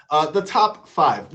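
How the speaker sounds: ordinary delivery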